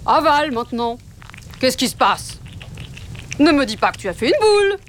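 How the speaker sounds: s'écrie, voix plus haute et plus forte; père loup qui s'exclame, à bout de patience; s'écria. Acted. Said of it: "s'écria Papa Loup". (Papa Loup s'écrie contre son fils, plus haut et plus fort)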